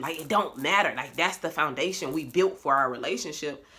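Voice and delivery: normal talking voice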